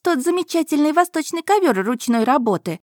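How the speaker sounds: put-on high, brisk demanding girl; pushy girl. A narrator's reading. Girl ordering that a carpet be taken, high demanding voice.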